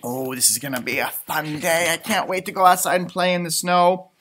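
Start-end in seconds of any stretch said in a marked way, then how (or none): none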